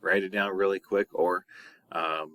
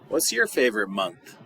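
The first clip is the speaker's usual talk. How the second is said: usual speaking voice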